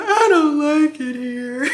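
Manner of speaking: hammed-up slow wail; imitated tearful whine, drawn out; mock crying. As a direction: wail it like comic sobbing, near laughter. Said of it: "I just started crying".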